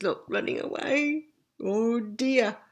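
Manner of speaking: ordinary delivery, nothing marked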